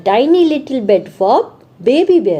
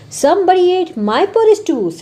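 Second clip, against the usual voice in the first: much higher, slow mama bear voice; 9 semitones higher, much slower than usual. Acted. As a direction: read it as a high-pitched mother bear. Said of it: "said Mama Bear". (ऊँची धीमी मम्मा भालू की आवाज़)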